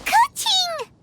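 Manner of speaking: a sharp high call to get attention. Real, not acted. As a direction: shout the name across the square. Calling out to someone nearby.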